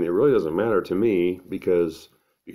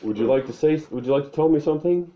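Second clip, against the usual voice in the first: high, quick, put-on fatherly prompting; exaggeratedly obliging dad, pitched up; good-dad act; 9 semitones higher, faster than usual. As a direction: act the ever-attentive father. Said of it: "I'll act like a good dad".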